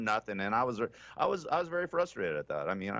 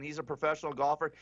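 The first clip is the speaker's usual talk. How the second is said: ordinary talk, nothing marked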